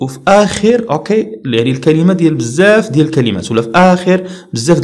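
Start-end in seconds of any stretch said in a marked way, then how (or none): none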